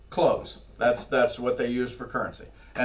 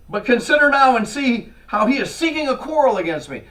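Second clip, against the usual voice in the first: paranoid king; 8 semitones higher, 6 dB louder than usual. Acted. King reads conspiracy into letter.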